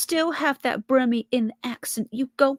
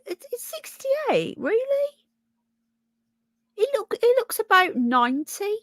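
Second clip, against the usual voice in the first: very high, squeaky disbelief; incredulous; 8 semitones higher than usual. Real, not acted. Streamer learns a man's stated age, incredulous. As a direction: pitch shooting up, stunned and doubting. Cannot believe the man is sixty-eight.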